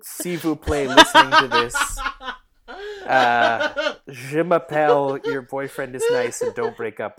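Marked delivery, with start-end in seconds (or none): 0.0-5.2 hammed-up French, laughing through it; mock French. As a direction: play a giggly fake Frenchman. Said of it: "the French will make its way into the episode".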